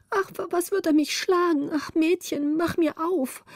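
put-on high, anxious child's plea; frightened cry. A narrator's reading. Little girl begs to be let out, fearing a beating, frightened. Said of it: "sah sie erschrocken in die Höhe".

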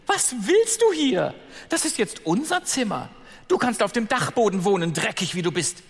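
hohe, laute, verächtliche Mädchenstimme; gehässige Stiefschwester. Acted. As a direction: fauch die Neue hochnäsig an. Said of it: "Eine rief". (Stiefschwester verjagt Ella aus ihrem Zimmer, hoch und gehässig)

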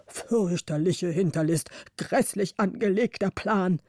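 a lightly voiced outraged old man; indignant councillor. A narrator's reading. Councillor turns to the others, lightly voiced outrage. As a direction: grumble about the treachery.

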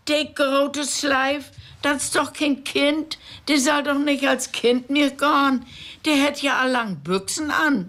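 lightly voiced fussy official; railwayman making a fuss. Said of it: "Der Eisenbahner mockt nu Wind".

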